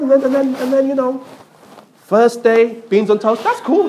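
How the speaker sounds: usual speaking voice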